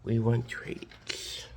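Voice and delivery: slow, whispered plea; drawn-out whispered imitation of dogs; dogs' whisper. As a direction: whisper it slowly as begging dogs. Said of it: "They were just like".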